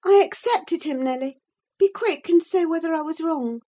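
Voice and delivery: put-on high, impatient young lady; high-pitched, urgent confession; Catherine. A narrator's reading. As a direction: blurt it high and press for an answer. Catherine impatiently demands Nelly's verdict.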